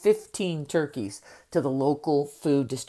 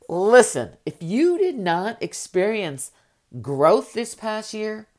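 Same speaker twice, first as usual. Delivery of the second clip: emphatic call-out; 6 semitones higher, 4 dB louder than usual. Real, not acted. Coach calling out listeners.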